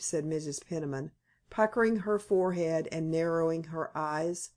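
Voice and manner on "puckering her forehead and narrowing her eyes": usual speaking voice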